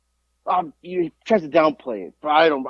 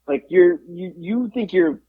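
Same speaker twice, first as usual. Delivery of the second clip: usual speaking voice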